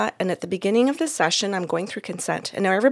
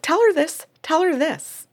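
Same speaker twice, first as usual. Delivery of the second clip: higher, louder eager repetition; eager urging; 8 semitones higher, 4 dB louder than usual. Retold or imitated. Mom in spirit urging the medium to pass it on, eager. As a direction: urge it twice, bursting to share. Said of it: "she's like".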